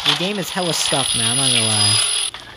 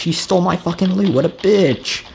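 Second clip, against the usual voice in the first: ordinary delivery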